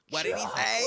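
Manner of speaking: high, forceful voice of someone in the background; background bystander. Acted. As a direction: play the bystander chiming in loudly. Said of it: "someone's gonna be like, in the background".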